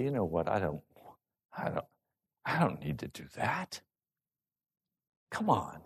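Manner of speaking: low, soft, halting grumble; sulky attitude. Acted. Balking at what you're supposed to do, sulky. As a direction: grumble under your voice, reluctant. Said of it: "you get an attitude, and you say".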